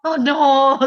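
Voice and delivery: loud dismayed "oh no"; dismayed groan. Retold or imitated. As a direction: groan in mock despair, loudly. (keluhan "oh no" yang keras, pura-pura putus asa)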